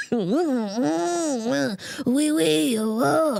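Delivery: hammed-up raspy French accent; sneering at a French speaker in a mock French accent. Mocking someone who brags about French, in a raspy mock French accent. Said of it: "not everybody's that raspy".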